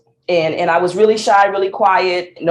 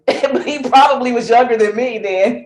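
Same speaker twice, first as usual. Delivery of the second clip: laughing; 6 semitones higher than usual. Real, not acted. Laughing at her own memory.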